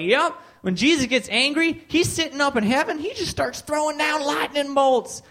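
high-pitched, worked-up bigot; mock zealous rant, pitched high; ranting bigot impression. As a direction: imitate a zealot preaching his nonsense with conviction.

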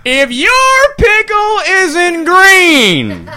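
very slow, loud, starting high and dropping; hammed-up slow punchline; drawn-out retort. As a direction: stretch out each word theatrically. Slow comeback about the pickle.

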